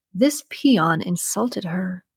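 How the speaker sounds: usual speaking voice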